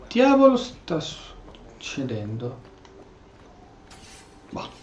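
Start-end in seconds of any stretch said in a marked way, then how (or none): none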